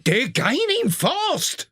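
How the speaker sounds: slow, high urgent cry; alarmed cry. A narrator's reading. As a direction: sound the alarm. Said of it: "cried the engine driver".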